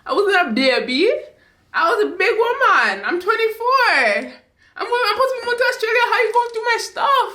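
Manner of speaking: very high, incredulous outburst; indignant protest. Retold or imitated. Twenty-four-year-old protesting being treated like a baby, indignant. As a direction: insist on being grown, voice climbing high.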